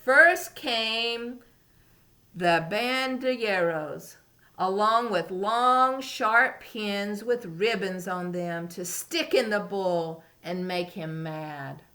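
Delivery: expressive picture-book narration; reading to a toddler. A narrator's reading. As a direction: tell it like storytime. Reading story to young children.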